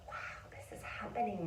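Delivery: hushed, amazed whisper; awed whisper. Retold or imitated. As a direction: whisper it in quiet wonder. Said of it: "the, like".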